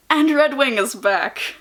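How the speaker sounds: high, drawn-out excited announcement; way too excited. Real, not acted. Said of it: "I'm sorry, I'm, like, way too excited".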